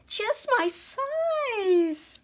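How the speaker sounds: high, squeaky mouse voice bursting with delight; thrilled squeak, slowing on "Just my size"; delighted little mouse. A narrator's reading. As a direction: gasp it out like a tiny mouse finding treasure. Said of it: "Mortimer sighed with delight".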